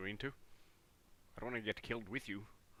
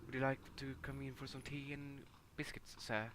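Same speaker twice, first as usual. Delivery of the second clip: put-on posh British accent; 3 semitones higher than usual. Inviting the visitor in, mock posh British. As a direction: offer tea in a prim British accent. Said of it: "So many good, uh, accents".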